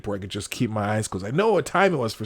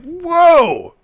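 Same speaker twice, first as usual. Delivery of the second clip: a sudden high, loud startled "whoa"; 10 dB louder than usual. Retold or imitated. Telling of waking from a melting dream, startled shout. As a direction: jolt up as if the vision caught you.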